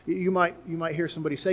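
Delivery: ordinary talk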